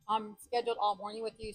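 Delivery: usual speaking voice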